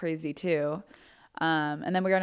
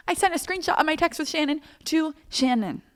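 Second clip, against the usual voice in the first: high-pitched, panicked wail; shrieky embarrassed disbelief; mortified confession; 11 semitones higher than usual. Acted. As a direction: squeal it out in horror at yourself. Horrified confession of her blunder.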